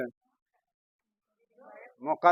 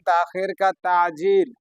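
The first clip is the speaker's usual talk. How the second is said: slow teaching demo; 4 dB louder, slower than usual. Shown as a demonstration. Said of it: "चलो हम ही बता दे रहे हैं".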